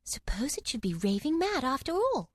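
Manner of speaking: timid whisper to herself. A narrator's reading. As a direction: breathe it out nervously. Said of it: "saying to herself".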